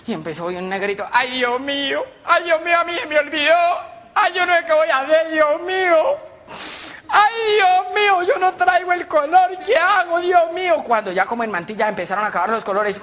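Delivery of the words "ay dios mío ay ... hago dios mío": high, hammed-up tearful wail; panicked whimpering. Schoolboy realizes he forgot his colour, panicked whimpering. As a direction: wail like a child in trouble.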